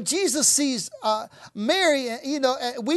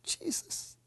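breathy whispered Jesus, almost silent; whispered prayer; 16 dB quieter than usual. Real, not acted. Preacher whispering Jesus to the congregation. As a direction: breathe his name in prayer.

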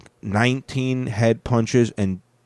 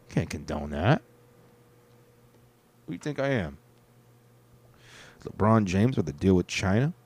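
ordinary delivery

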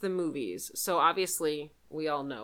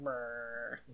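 imitated chopper noise; 6 semitones lower, 9 dB quieter than usual. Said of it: "He was just like".

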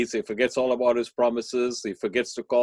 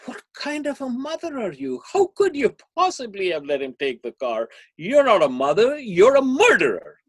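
high, louder accusation building to a cry; accusing outburst; 13 semitones higher, 5 dB louder than usual. Acted. Friend accusing the grieving mother, high and loud. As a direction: hurl the blame at her.

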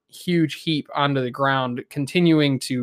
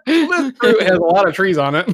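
laughing joke; 5 semitones higher, 6 dB louder than usual. Real, not acted. Joking back while laughing.

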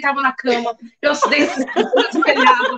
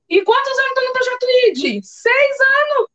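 excited self-mockery; 11 semitones higher than usual. Real, not acted. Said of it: "self-mocking exclamation".

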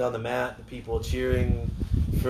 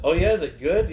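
joking around; 5 semitones higher, 5 dB louder than usual. Retold or imitated. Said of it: "joking around".

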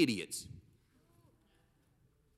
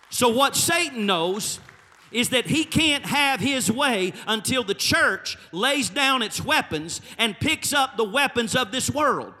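louder, rising preacher cadence over the music; full-force preaching; 6 semitones higher, 5 dB louder than usual. Acted. Preacher rousing the church to look to God, full-force preaching. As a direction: drive it home to the church, punching each line.

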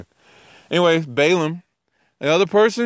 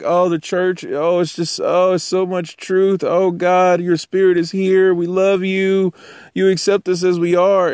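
mock gushy praise; 6 semitones higher than usual. Mocking gushy churchgoers.

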